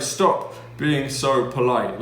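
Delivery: slow, emphatic telling-off; retold reprimand, each word weighted; mock scolding. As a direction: imitate an exasperated teacher, drawn out. Retelling a teacher's scolding.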